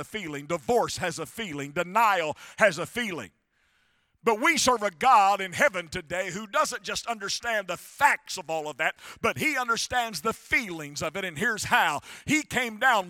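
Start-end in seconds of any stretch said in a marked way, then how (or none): none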